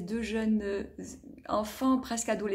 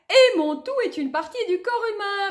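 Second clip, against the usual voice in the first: much higher, louder triumphant answer; eager answer; 10 semitones higher, 7 dB louder than usual. Acted. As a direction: blurt the answer proudly. Child answers riddle.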